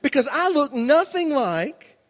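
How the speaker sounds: hesitant question. Retold or imitated. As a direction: work up the nerve to ask mom. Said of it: "I said".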